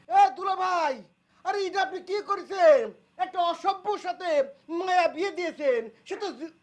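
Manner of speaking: loud, high-pitched protest; outraged protest. Acted. Protesting the niece's marriage to brother-in-law, outraged. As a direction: call him out at high pitch.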